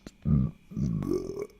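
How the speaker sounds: fake burp. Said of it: "I'm not really burping there".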